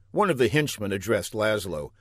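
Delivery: usual speaking voice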